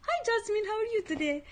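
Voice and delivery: high-pitched, fake-sweet hello; mock-friendly greeting. Roommate greeting her to her face, mock-sweet and high. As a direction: imitate her smiling, two-faced greeting. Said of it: "she'll be smiling in my face".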